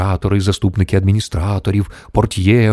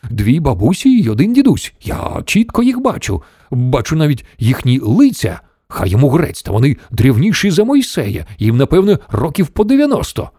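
high, insistent report; exclaiming; 7 semitones higher than usual. A narrator's reading. Shuckworth describes the old people in nightshirts, exclaiming. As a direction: insist on it with pitch raised. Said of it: "вигукнув бідолашний Шакворт".

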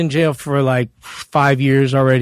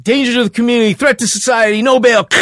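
high, loud list of pronouncements; imitated court ruling; 8 semitones higher, 5 dB louder than usual. Court refusing him at bail hearing, imitated high loud ruling. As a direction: imitate officials rattling off a verdict.

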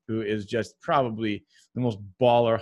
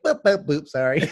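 goofy alien voice. Acted. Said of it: "And he's like".

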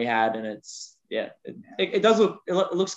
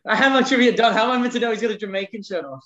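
higher, laughing mock outrage; laughing protest; 6 semitones higher, faster than usual. Real, not acted. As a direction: protest through laughter at the spoiled surprise. Trivia answer spoiled by co-host.

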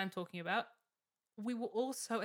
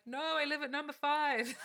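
higher, insistent imitation of colleague; insistent correction; 6 semitones higher, 3 dB louder than usual. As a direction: correct the operator firmly, pitch raised. Colleague corrects the wrong address.

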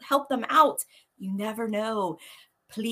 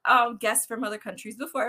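ordinary talk

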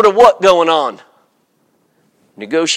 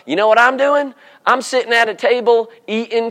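normal talking voice

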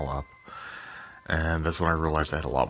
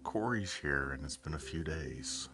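sexy voice; 10 dB quieter than usual. Real, not acted. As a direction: purr it out softly. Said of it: "Sorry, I'm using my sexy voice".

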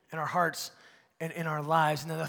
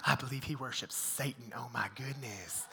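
softer, hushed clucking church critic; mock scandalized gossip; 6 semitones lower, 9 dB quieter than usual. Critics of the pastor, scandalized and hushed. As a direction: gasp it like a shocked old churchgoer. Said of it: "they were like".

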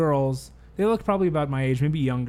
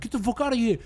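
higher-pitched mock angry outburst; acted aggression; 8 semitones higher, faster than usual. Angry club stranger to a dancer, mock aggressive. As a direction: imitate an aggressive stranger's order.

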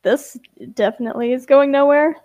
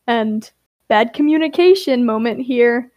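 usual speaking voice

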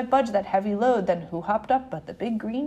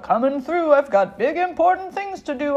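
brash, louder dump truck yell; 2 semitones higher, 5 dB louder than usual. A narrator's reading. Dump truck to everyone in its way, yelled. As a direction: yell it like a self-important truck. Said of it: "yelled a dump truck".

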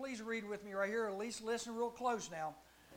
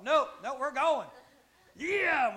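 insistent shout; 5 semitones higher, 12 dB louder, slower than usual. Pretend. Pastor insisting the recruit comes.

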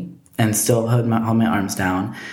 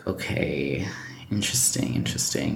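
near-whispered, drawn-back mock musing; retold hushed reaction; 6 semitones lower, 7 dB quieter than usual. Telling friend how she took his news, hushed. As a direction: say it under your breath, barely voiced.

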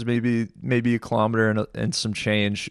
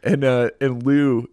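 usual speaking voice

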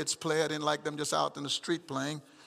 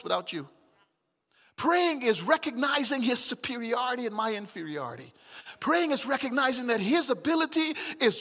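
loud, high, rising preacher's cry; fervent pulpit preaching, much louder and higher; preaching at full force; 8 semitones higher, 7 dB louder than usual. Acted. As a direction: preach it out, building to a shout. Preaching on the meaning of prayer.

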